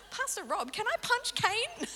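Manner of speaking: high, squeaky mock indignation; a squeaky half-laughing protest; mock outraged squeal. Retelling her reply to the pastor, a squeaky mock protest. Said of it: "I was like".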